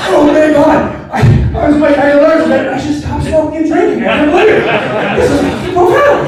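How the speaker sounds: high, loud hammed-up revelation; mock amazement. Patient leaving hospital with new advice, mock amazement.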